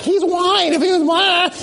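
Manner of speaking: mock insistent whites, voice pitched high and shouted. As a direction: sbeffeggia il punto di vista dei bianchi. Whites' view voiced, mocking.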